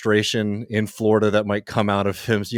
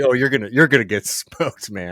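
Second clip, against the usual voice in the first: louder mocking jab with a laugh; laughing taunt; 5 semitones higher, 5 dB louder than usual. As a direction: taunt him through a laugh. Mocking DeSantis's weak response.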